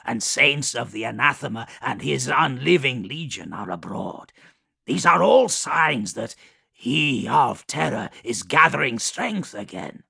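eager, loud plague daemon; Kugoth voice. Acted. Kugoth speaks, higher and louder.

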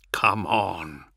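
whispery mutter under the breath; tense, half-whispered muttering to oneself. A narrator's reading. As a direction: urge it on under your breath. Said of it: "he mutters".

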